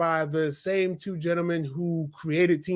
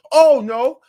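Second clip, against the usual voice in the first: sudden loud, higher exclamation; mock alarm; 6 semitones higher, 10 dB louder than usual. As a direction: burst out with a pretend objection. Reacting to imagined characters.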